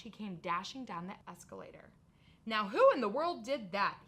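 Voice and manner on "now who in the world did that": much louder startled demand; loud exclamation. Acted. Night watchman, hearing the crash, demands who did it loudly. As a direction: call out suspiciously into the dark. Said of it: "he exclaimed".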